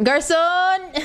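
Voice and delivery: calling out. Pretend. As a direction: call out for the garçon. Calling for the waiter.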